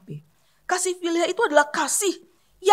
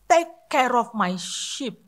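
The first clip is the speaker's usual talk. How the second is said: slow deliberate; 3 semitones lower, much slower than usual. Shown as a demonstration. Preacher reading Jesus' second command slowly to the church. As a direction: Take care of my sheep drawn out very slowly. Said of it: "kata yang kedua adalah".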